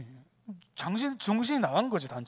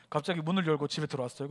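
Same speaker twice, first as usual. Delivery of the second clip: ordinary delivery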